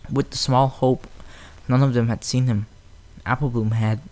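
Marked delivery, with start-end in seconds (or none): none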